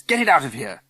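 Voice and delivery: loud, indignant command; shouted order. A narrator's reading. Governor ordering the dog out, shouting. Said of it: "she shouts".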